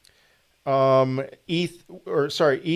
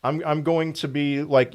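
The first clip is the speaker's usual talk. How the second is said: ordinary delivery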